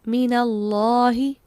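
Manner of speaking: मिनल्लाही said slowly, fatha on n; slow demo reading. Shown as a demonstration. Teacher shows students the example word, slow. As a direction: read मिनल्लाही slowly, nun with a. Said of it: "आप देखिए".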